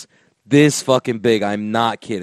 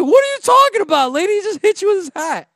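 high, rapid-fire indignant protest; incredulous outburst; faster than usual. Retold or imitated. Passenger to the mom who claims to understand, high fast incredulity. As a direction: rattle it out in high-pitched disbelief. Retelling his outrage at the mom.

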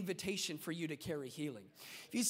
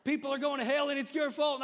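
much higher, louder mock yell of doom; loud evangelist; 7 semitones higher, 6 dB louder than usual. Evangelist yelling blame at a nine-year-old, hammed-up. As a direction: bellow the blame at the crowd. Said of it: "he'd be like".

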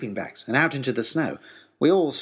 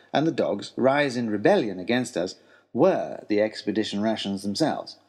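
ordinary delivery, nothing marked

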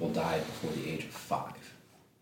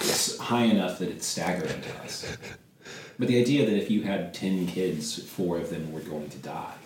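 normal talking voice

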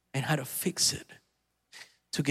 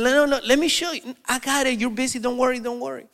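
insisting; 7 semitones higher than usual. Retold or imitated. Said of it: "So I said".

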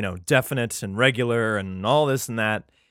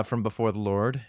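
ordinary delivery

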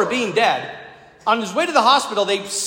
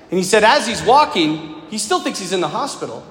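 usual speaking voice

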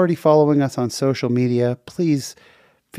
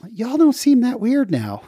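higher, lightly imitated Southern accent; pitched-up hint of a Southern accent; 12 semitones higher than usual. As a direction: quote her in a mild, amused Southern accent. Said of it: "She said in her Southern accent".

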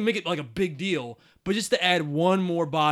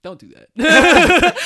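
laughing protest; 11 semitones higher, 15 dB louder, much slower than usual. Real, not acted. Laughing protest at friend's jab.

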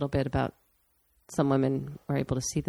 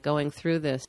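normal talking voice

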